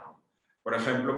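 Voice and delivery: normal talking voice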